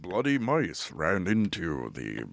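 low, very slow spooky narrator; Haunted Mansion voice. Retold or imitated. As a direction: ghost host from a haunted ride. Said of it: "that Disney voice, the Haunted Mansion voice".

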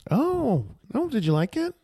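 very high, eager question; high-pitched eager voice. Retold or imitated. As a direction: gush it up high and hopeful. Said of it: "It's like".

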